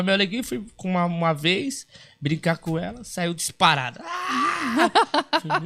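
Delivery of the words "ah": high mock scream of the girl; imitated scream. Dwarf mimics the girl fleeing, high squeal.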